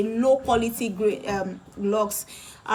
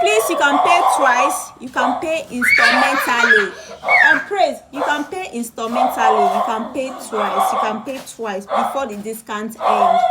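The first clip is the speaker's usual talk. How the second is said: loud, insistent call about paying twice; emphatic shouting; 7 dB louder than usual. Real, not acted. Seller shouting payment terms to viewers over barking, loud. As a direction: shout it out to be heard over the dog. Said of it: "Yes, I'm emphasizing".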